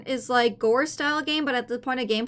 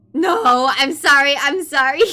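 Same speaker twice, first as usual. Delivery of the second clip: loud apology; 10 dB louder than usual. Real, not acted. Laughing loud apology to chat.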